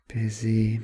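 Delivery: sighed, low and curt. Acted. Writer brushing off the waitress, low sighed reply. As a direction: brush her off with a sigh.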